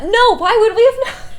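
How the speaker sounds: high, loud laughing disbelief. Real, not acted. Laughs at the absurd plot.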